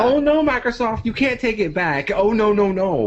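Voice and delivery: high, loud gloating voice; mocking girlfriend. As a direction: tease Microsoft like a gloating fan. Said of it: "She's just like".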